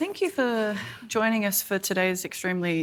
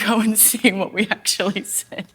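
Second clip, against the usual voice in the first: ordinary delivery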